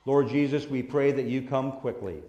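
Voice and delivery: soft, reverent prayer voice. Acted. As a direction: pray quietly over the children. Closing prayer with eyes closed.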